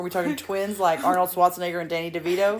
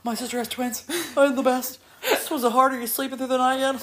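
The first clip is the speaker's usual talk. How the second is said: higher, louder eager aunt chatter; gushing aunt; 7 semitones higher, 5 dB louder than usual. Retold or imitated. Aunt mocking herself gushing at a twin mom, higher and louder. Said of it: "I'm like".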